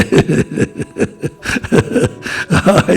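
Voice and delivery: real, breathy chuckling; amused laugh. Real, not acted. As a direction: chuckle at length, mostly without voice. Amused at impossibility of discussion.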